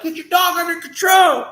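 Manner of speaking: high, loud shout through a shut door; frantic yell at the cousin to control his dog; yelled order. Retold or imitated. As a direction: bark the order from behind the door. Friend yelling from behind door.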